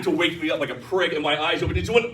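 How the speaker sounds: normal talking voice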